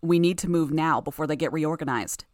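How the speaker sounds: ordinary delivery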